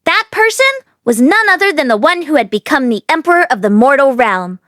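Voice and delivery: usual speaking voice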